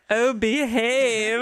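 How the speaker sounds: slow, drawn-out cheeky catchphrase; comic British playboy impression; Austin Powers. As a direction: stretch it out like a British spoof spy.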